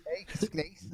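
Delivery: put-on voice. Jokey put-on voice.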